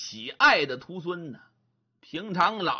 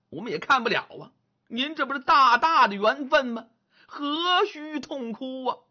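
higher, teasing disciple voice; mocking disciple; 7 semitones higher than usual. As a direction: needle the old man for crying. Disciple teasing senile master.

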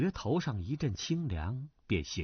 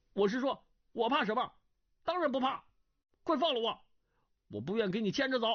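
put-on higher, earnest young scholar's voice; a raised, indignant young gentleman; young man Duan Yu; 9 semitones higher, faster than usual. A narrator's reading. (升高音调的年轻书生声)

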